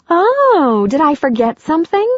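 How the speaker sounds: playful high voice for a pet; Andy to the dog. Acted. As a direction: sing it out to the waiting dog.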